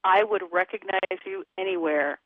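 ordinary delivery

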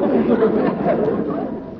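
breathy laugh. Real, not acted. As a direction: burst into a laugh. Laughs at the joke.

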